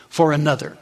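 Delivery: normal talking voice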